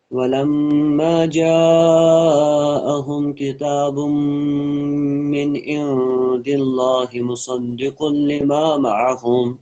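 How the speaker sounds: slow melodic tajweed recitation; slow chanted Quran verse. Acted. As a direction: draw each syllable out in chant.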